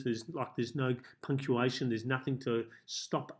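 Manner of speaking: ordinary delivery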